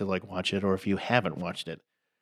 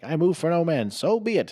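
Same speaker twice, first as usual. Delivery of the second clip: mock knight; 8 semitones higher, 3 dB louder than usual. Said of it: "He's like".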